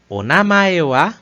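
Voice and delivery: rising tune on namae wa; uptalk. Shown as a demonstration. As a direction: lift the last sound of "O namae wa?" up. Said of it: "a gente sobe no último som". (subindo no fim de "O namae wa?")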